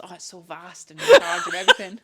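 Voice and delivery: loud dreamy mock mystic; mock spiritual guru. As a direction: ham up a pretentious guru. Mocking Bradley's universe talk.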